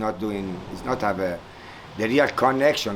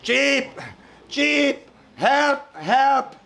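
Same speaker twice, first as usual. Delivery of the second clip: very high urgent chick cries; chirping strongly; 13 semitones higher, much slower than usual. Acted. Stuck chick calling out again for help, very high. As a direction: cry for help again, harder. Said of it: "They start again, strongly".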